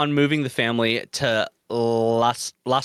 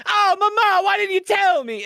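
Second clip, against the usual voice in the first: much higher, louder hammed-up wail; mock Italian son; 5 dB louder than usual. Retelling a Family Guy skit, much higher hammed-up son wail. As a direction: wail it like a cartoon mobster son. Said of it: "he's like".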